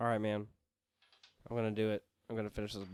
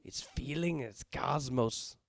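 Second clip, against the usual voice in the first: hammed-up cosmic villain. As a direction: ham up a power-drunk villain. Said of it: "You're like".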